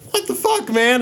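very high, panicked squeal; freaking out. Acted. Player acting out a character freaking out, high squeal. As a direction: yelp it in mock panic. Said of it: "And I'm freaking out".

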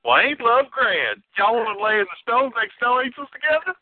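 imitating a Southern outlaw's lazy twang; Walton Goggins impression. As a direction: do a high-pitched Southern cowboy. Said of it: "Walton Goggins like".

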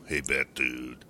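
lightly voiced cartoon stoner; half-hearted impression of a stoner bus driver; Otto. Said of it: "you just have to sound like Otto".

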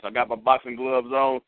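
normal talking voice

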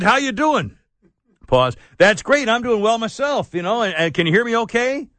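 a hint of a cheery canned sales pitch; canned telemarketer tape. Mocking the tape call to listeners, a lightly mimicked cheery salesman. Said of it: "he put pauses in for me".

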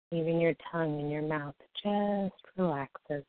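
slow, soft soothing meditation voice; guided meditation. Acted. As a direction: ease them gently into calm.

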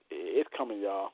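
normal talking voice

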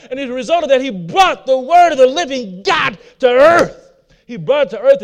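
preacher builds to a peak; building preaching. Acted. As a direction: swell the sermon up to "the living God". Preaching builds after the prayer.

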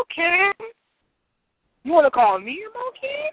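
high-pitched indignant disbelief. Real, not acted. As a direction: challenge the insult, voice shooting way up. Indignant reply to the insult.

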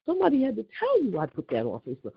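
usual speaking voice

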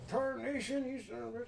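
put-on high-pitched cranky old prospector. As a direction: curse like a grizzled old-timer. Player voices cursing character.

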